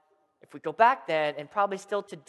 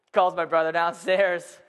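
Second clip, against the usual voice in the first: usual speaking voice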